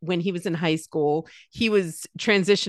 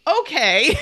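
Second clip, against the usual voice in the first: amused okay; 9 semitones higher, 5 dB louder than usual. Retold or imitated. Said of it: "And so I'm like".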